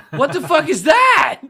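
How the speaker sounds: very high, drawn-out screamed disbelief; outraged scream. Retold or imitated. Retelling his reaction to the DJ's promo, screaming. Louder second retold shocked reaction.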